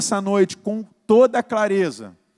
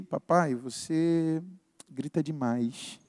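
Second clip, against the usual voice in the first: soft, slow and lower, careful not to hurt; delicate, careful; 4 semitones lower, 7 dB quieter, slower than usual. Retold or imitated. Daughter gently telling dad he yells, delicate and careful. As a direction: say it gently, easing into the hard truth. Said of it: "Mais delicada, né? Sem querer me ferir".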